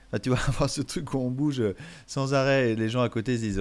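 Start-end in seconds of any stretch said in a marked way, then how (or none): none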